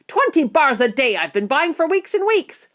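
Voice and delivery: angry shout. A narrator's reading. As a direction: protest with rising indignation. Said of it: "a boy shouted angrily".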